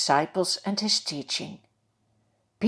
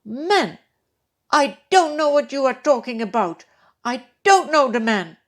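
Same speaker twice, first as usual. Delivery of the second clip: loud, higher angry oath; cursing denial; 6 semitones higher, 7 dB louder than usual. Acted. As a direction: curse and deny with force. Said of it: "He begins to call down curses".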